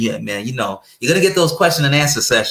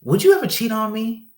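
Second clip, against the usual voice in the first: put-on high girlfriend voice; imitating girlfriend; 10 semitones higher than usual. Girlfriend asking about cheating, high voice. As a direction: lift up and ask it sweetly. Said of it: "so she's like".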